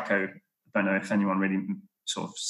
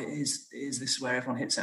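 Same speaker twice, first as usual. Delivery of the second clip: put-on higher, softer old lady caddy; 5 semitones higher, 7 dB quieter than usual. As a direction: softly insist like the caddy. Caddy voiced in the story.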